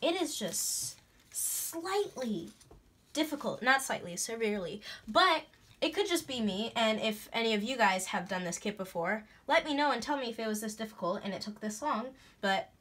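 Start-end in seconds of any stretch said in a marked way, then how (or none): none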